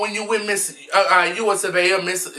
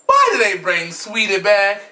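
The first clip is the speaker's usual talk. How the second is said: ordinary talk, nothing marked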